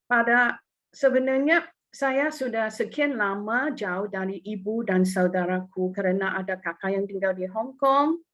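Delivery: normal talking voice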